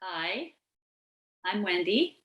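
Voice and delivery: usual speaking voice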